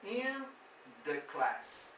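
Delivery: teacher marking "in" for the class; in stressed. Shown as a demonstration. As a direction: hit the word "in" clearly. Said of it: "Se eu quero falar na classe, falo".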